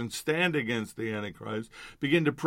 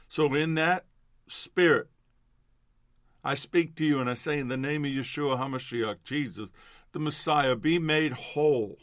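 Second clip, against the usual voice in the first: preaching declaration; 2 semitones higher, 3 dB louder than usual. Acted. Minister declaring healing over listeners, preaching at full force. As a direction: speak it over them with rising authority.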